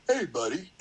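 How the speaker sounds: lightly voiced big guy. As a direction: lightly imitate a big guy hailing someone. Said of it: "He's like this big guy".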